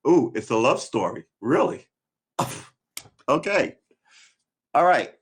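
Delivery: loud, high-pitched surprised reaction. Real, not acted. Reader finding the film is a love story, real surprise. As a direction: react aloud to an unexpected plot twist.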